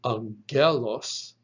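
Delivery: angelos with the stress on GEL: ang-GEL-os; ang-GEL-os, emphasis in the middle; middle-syllable stress. Shown as a demonstration. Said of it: "It is not pronounced angelos".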